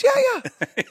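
very high eager reply. Retold or imitated. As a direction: chirp it out cheerfully. Phil voiced, very high.

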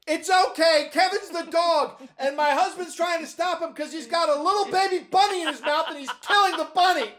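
high, loud yelled explanation; wife yelling down. Retold or imitated. Wife yelling down to the soccer coach, high and loud. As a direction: call down to the field, laughing. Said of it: "Lisa yells down".

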